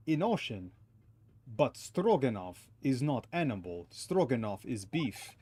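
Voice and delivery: put-on huffy Russian-accented sailor. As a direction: play a stubborn Russian crewman. Voices Russian sailor character.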